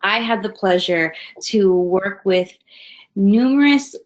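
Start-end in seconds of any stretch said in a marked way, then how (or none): none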